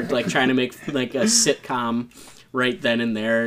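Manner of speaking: normal talking voice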